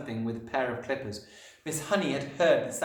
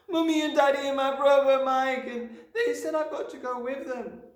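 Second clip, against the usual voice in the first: put-on very high, upset little girl; loud, high-pitched dismay; distraught child; 7 dB louder than usual. A narrator's reading. Distraught child explains to teacher.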